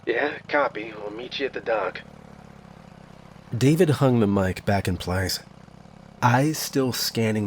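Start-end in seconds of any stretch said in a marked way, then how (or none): 0.1-2.0 terse voice over crackly radio; brisk quiet reply through static; clipped radio voice. Acted. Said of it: "George's voice came through, clipped".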